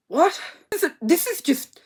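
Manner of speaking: baffled outrage; disbelieving exclamation; incredulous. Real, not acted.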